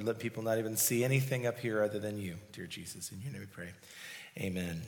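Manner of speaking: soft, low and quiet prayer; hushed closing prayer fading to amen. Acted. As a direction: pray humbly to the Lord, hushed.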